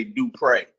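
"Dupree" said doo-PRAY, ending in an ay sound; accent on the E. Shown as a demonstration. Guest corrects the host on his name: "Dupree" as doo-PRAY. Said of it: "put that accent over my E".

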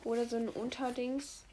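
usual speaking voice

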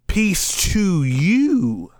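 grand, lingering proclamation of a greeting; slow, lifted quote; drawn-out greeting. Acted. Quotes risen Jesus's greeting.

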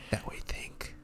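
soft conspiratorial whisper; whispered aside. Real, not acted. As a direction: hush it like a secret.

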